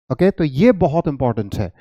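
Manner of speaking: usual speaking voice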